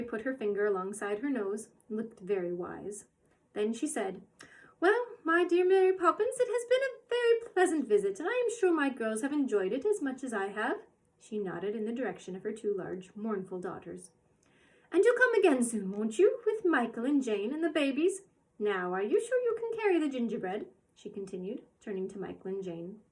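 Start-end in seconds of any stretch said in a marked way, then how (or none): 4.8-10.8 put-on gracious old woman. Mrs Corey's gracious farewell. 14.9-20.7 put-on fussing little old woman. Mrs Corey fussing.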